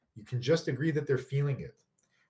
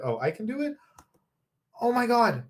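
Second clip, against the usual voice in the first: sudden high delighted gasp; thrilled to find it works; excited; 10 semitones higher, 3 dB louder than usual. Real, not acted. Streamer discovering a new feature, excited.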